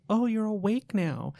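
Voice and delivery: lightly voiced little girl.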